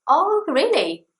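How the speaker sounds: high, slow interested gasp; surprised. Shown as a demonstration. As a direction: sound amazed and engaged. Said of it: "something like".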